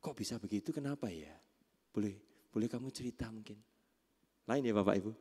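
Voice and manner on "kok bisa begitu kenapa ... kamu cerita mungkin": soft, lowered, inviting questions; acted patient, quiet inquiry; gentle coaching. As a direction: invite them softly to open up. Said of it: "Coaching itu begini".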